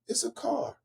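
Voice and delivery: flat letdown. Real, not acted. Deflated punchline.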